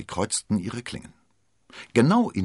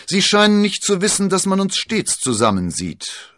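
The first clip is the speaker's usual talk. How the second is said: coolly; 14 semitones higher, 9 dB louder than usual. Acted. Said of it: "sagte der verwundete Musketier kühl".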